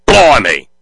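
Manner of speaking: loud, drawn-out Aussie exclamation; cheesy Australian accent. Acted. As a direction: blurt it as a hammed-up Australian kid. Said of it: "my cheesy Australian accent".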